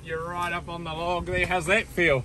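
ordinary talk, nothing marked

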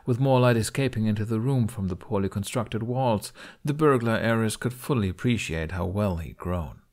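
plain reading voice

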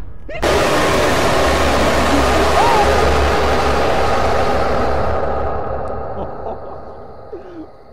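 long, high, loud shrieking laughter. Real, not acted. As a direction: howl with laughter, breathless. Laughing after getting caught.

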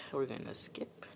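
whispered aside. Real, not acted. Muttered aside during vote.

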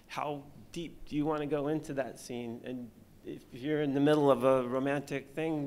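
ordinary talk

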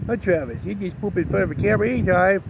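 teasing the dog for pooping on camera; talking to the dog. Real, not acted.

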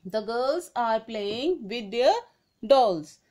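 slow, careful classroom read; dictating the example to students; slow dictation read. Shown as a demonstration. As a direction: read the sentence out word by word. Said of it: "Tell me, कौन से pronoun है इसमें?".

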